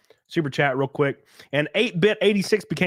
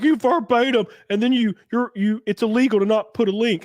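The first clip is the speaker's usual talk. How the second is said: mocking critics; 8 semitones higher than usual. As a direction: drag out a dumb "You verbatim".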